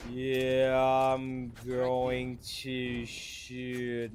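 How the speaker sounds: drawn-out slow-mo voice; slow-motion voice. Shown as a demonstration. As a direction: stretch every word like a replay. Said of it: "you can't do a good slow-mo voice".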